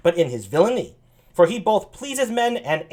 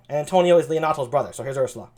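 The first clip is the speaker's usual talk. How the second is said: ordinary talk, nothing marked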